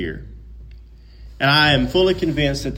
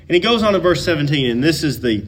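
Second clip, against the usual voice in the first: normal talking voice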